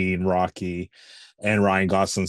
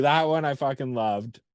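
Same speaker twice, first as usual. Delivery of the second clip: ordinary delivery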